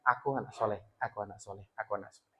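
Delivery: soft inner mantra. Shown as a demonstration. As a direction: murmur it like a child's own thought. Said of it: "tercantum dalam kehidupannya".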